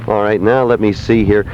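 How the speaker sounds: ordinary delivery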